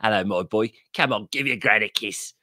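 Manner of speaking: Nan voice. Retold or imitated. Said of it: "She goes".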